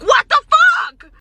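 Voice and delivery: high, stretched-out indignant yell; retold outraged shout. Retells snapping at the man.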